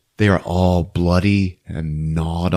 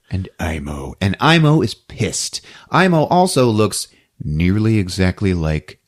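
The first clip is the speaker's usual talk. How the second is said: normal talking voice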